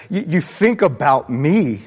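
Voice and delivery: normal talking voice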